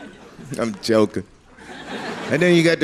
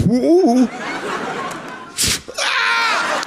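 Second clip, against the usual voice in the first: pained scream; 13 semitones higher, much slower than usual. Acted. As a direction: yelp as if stabbed in the leg. Mock stabbing scream.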